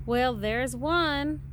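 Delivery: ordinary delivery, nothing marked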